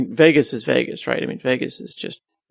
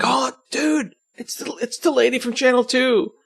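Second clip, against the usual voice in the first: excited fan; 11 semitones higher, slower than usual. Retold or imitated. Fan spotting the weather lady.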